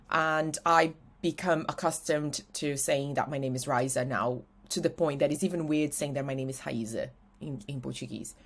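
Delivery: ordinary talk, nothing marked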